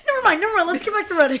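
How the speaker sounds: high, loud flustered rush; Monk cutting off the dance talk, high and flustered; flustered dismissal. Retold or imitated. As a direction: brush it off fast, embarrassed. Monk flustered, changing subject.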